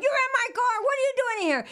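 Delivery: shrill panicked accusation; high shocked yell. Retold or imitated. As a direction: accuse him in high alarm. Confrontation in car.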